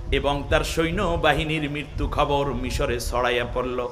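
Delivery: half-sung preacher's storytelling; melodic sermon narration. Acted. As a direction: intone it gently, ending in a drawn-out chant. Preacher intones the account.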